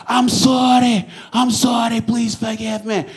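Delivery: high, slow pleading in a bad Scottish accent. Scottish stranger apologising, pleading in a bad Scottish accent. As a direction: beg in a poor Scottish accent. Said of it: "a shit Scottish accent".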